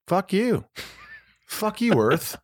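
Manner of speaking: high-pitched exasperated outburst; fed-up God. Acted. Imagined God addressing Earth, acted exasperation. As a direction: play an exasperated God telling humanity off.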